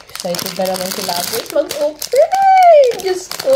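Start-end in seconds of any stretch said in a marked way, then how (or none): 2.4-3.6 high, slow gasp of delight; surprised squeal. Real, not acted. Vlogger opening a blind box, high drawn-out squeal. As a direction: squeal it out, stretched long.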